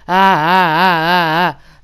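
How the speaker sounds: loud, high imitated screams; mock screaming. Imitating the masked man screaming beside him on the ride, loud. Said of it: "he was just screaming".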